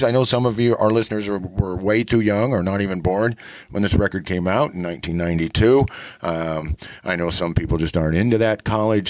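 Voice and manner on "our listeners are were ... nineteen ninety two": ordinary talk, nothing marked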